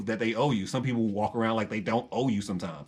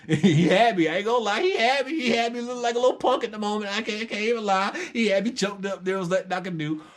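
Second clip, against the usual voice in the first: high, laughing disbelief at himself; sheepish real laughter while admitting defeat; 9 semitones higher than usual. Real, not acted. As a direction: laugh through the memory of being choked. Laughs at own humiliation.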